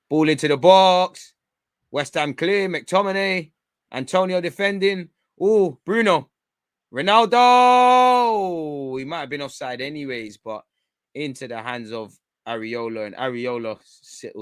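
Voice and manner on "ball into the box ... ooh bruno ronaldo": building from a loud call to a long, stretched, much higher shout; rising with the attack until the name bursts out; excited match call. Real, not acted. Fan watching along, calling the chance as it builds, excitedly.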